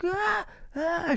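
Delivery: high, thin fake cries of pain; hammed-up squeals of hurt; exaggerated pained wail. [name] in the passenger seat after the crash, fake wailing.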